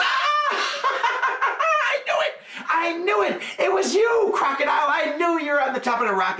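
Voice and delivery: high, laughing I knew it; triumphant monkey. A narrator's reading. Monkey catching the crocodile out, high and gleeful. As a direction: crow with delight, way up high.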